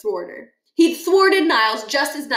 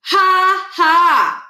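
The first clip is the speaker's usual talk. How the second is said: flat spoken ha ha; 8 semitones higher, 7 dB louder than usual. Acted. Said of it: "He actually said, "Ha, ha".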